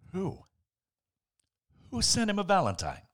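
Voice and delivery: plain reading voice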